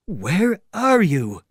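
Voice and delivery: high, loud, drawn-out startled question; bewildered tramp. Acted. Tramp to a speaker he cannot see, bewildered. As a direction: call out slowly in bewilderment. Tramp finds nobody behind him.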